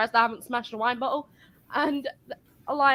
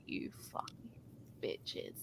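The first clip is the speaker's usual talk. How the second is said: breathy whisper under the breath; whispered curse; 22 dB quieter than usual. Retold or imitated. Whispered curse at rude customers from the cashier. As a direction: hiss it so no one hears.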